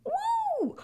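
high-pitched gushing praise, rising to a whoop; champion voice. Acted. Inner champion celebrating a recorded podcast, high gleeful cheering. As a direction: gush with delight, then whoop. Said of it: "I wanna listen to that champion voice".

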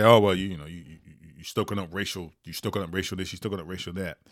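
low, very soft mimicry of a complainer; imagined critic's grumble.

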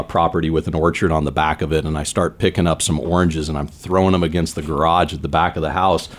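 normal talking voice

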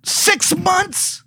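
very high squeak of disbelief; mock-shocked falsetto echo; incredulous. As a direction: squeak it out, outraged at the answer.

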